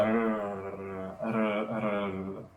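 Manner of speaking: "Arr" with a long, strongly rolled R. Shown as a demonstration. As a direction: roll the R in "Arr" hard, each time. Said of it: "Stronger R, please".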